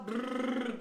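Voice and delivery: a buzzing brrr through loose lips; lip trill. Shown as a demonstration. A teacher showing the class, a lip trill. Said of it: "blow out the lips".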